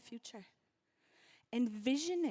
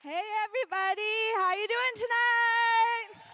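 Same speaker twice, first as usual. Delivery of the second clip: hype MC; 12 semitones higher, 14 dB louder, slower than usual. Real, not acted. MC hyping the crowd.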